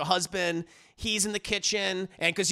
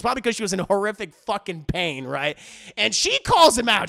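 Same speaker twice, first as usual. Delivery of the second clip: ordinary delivery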